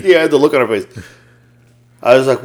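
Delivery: ordinary talk, nothing marked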